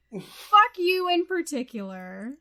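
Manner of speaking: ordinary talk, nothing marked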